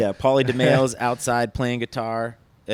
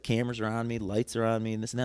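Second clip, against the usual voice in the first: ordinary talk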